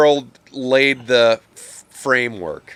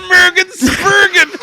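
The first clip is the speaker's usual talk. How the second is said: high squeal through laughter; helpless laughing; 4 dB louder than usual. Real, not acted. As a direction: barely get the silly name out while cracking up. Cracking up at the garbled name.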